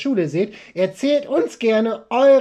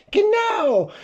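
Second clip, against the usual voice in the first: high squeaky puppet voice; silly clown; 12 semitones higher than usual. Acted. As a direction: chirp it like a cheeky puppet. Second puppet answers.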